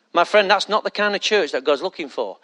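ordinary delivery

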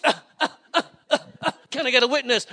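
loud, gasping preacher parody; mock preacher. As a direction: pump out grunts, then call for a witness. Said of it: "there's this total different person".

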